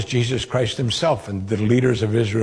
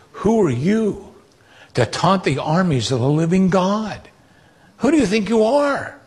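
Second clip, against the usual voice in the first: defiant challenge; 7 semitones higher than usual. Retold or imitated. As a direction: fling the question at Goliath, much higher, unafraid. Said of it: "And David's defiant".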